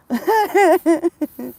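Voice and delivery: loud, high rolling giggles; giggling fit. Real, not acted. Laughing fit.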